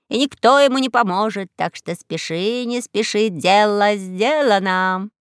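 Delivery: raspy cawing crow. Acted.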